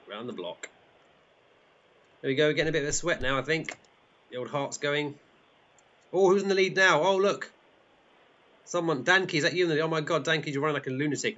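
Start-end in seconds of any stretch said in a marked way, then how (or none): 6.1-11.4 high, breaking-news surprise; excited race commentary. Pretend. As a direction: react to a new front-runner. Commentating on change of leader.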